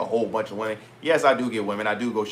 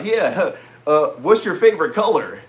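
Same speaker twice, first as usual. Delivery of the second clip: mock lame opener; 5 semitones higher, 5 dB louder than usual. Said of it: "I'm not gonna go in there and be like".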